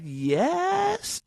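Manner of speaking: "Yes" drawn out, climbing very high at the end; hesitant, high-rising "Yes", as if asking; questioning yes. Retold or imitated. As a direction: stretch "Yes" into a big unsure question. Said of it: "like, questioningly".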